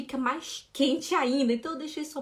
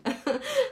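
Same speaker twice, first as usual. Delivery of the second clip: bursting into high laughter; real laugh; 11 semitones higher than usual. Real, not acted. Teacher laughing at a student practising on the bus. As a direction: laugh out at the thought.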